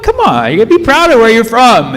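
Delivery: high, loud mock insistence; retold urging. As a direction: push them to be proud, hammed-up. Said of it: "I'm like".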